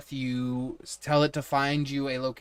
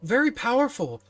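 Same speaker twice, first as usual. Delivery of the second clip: much higher, louder eager admirer; Tyson; 10 semitones higher, 6 dB louder than usual. Acted. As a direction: gush with awe at the master. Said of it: "Tyson's gonna ... be like".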